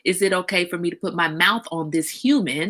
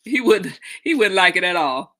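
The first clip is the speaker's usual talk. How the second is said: ordinary talk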